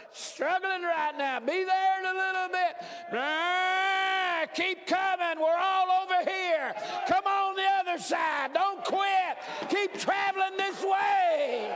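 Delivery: high, loud shouted sheep calls; sheep calling out. Retold or imitated. Flock on the knoll shouting encouragement to the climbers. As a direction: holler like the flock cheering the stragglers on. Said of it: "they'd answer".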